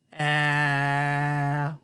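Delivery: a long steady humming "A"; long voiced vowel. Shown as a demonstration. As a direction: sustain "A" so the throat vibrates. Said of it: "Try the "a" sound and feel your throat".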